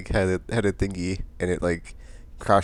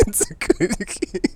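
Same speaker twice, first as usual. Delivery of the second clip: high, slow mocking echo of his line; 13 semitones higher, much slower than usual. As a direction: parrot his words back high and drawn out. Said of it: "echoing him".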